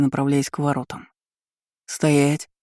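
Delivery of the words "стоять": sharp guard's bark; barked order. A narrator's reading. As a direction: bark the command at them. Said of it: "рявкнул". (резкий окрик стражника)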